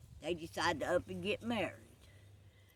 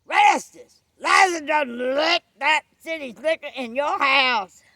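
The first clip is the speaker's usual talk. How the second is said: high, very loud scandalized holler; alarmed shout; 9 semitones higher, 16 dB louder than usual. Acted. Ralph telling Rastus what he sees at the house, alarmed shout. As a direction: yell out the shocking news.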